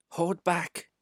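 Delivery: ordinary delivery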